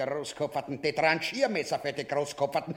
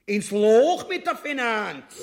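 furious shout; 7 semitones higher, 5 dB louder than usual. Acted. King bellows his order.

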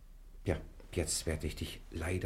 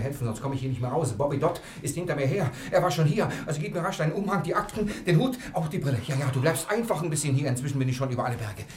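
higher, louder rushed urgency; panicky, louder and higher, racing at the end; urgent panic; 7 semitones higher, 7 dB louder, faster than usual. Acted. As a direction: hurry him, the pursuer is close. Hurried escape, urgent pleading.

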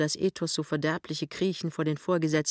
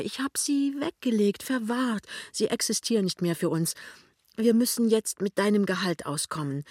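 eindringlich; 5 semitones higher than usual. A narrator's reading. Lämmchen erklärt eindringlich.

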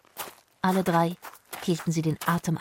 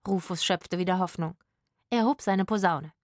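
ordinary delivery, nothing marked

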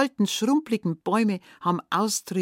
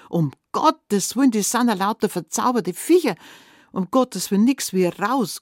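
higher, louder burst of mild panic; alarmed exclamation; 5 semitones higher, 5 dB louder than usual. Acted. Boy sees the bewitched women's animal legs, alarmed. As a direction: blurt it out in alarm.

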